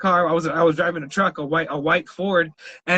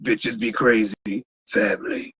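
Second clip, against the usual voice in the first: much lower, soft gravel-free murmur of a tough guy; deep, quiet action-hero voice; Vin Diesel impression; 9 semitones lower, 3 dB quieter than usual. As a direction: drop it way down like a movie action star. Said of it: "Vin Diesel came out and he was like".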